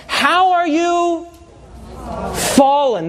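slow, drawn out How; dramatic scripture reading. Acted. Preacher reading Isaiah's lament over Lucifer slowly.

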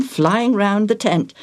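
plain reading voice

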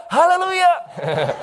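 high, slow cry of praise; joyful cry. Acted. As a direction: sing it out in wonder. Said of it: "I couldn't help but cry".

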